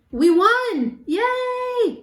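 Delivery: high, celebratory cheer. Real, not acted. Cheers the end of the game.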